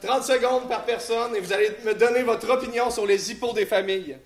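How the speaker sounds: game-show host announcing; announcer. Acted. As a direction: announce the next round. Host announces the next round. (ton d'animateur de spectacle)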